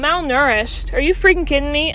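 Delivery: loud indignant outrage at the doctor; exasperated outburst. Retold or imitated. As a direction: blow up at the absurd diagnosis. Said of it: "And I'm sitting here going".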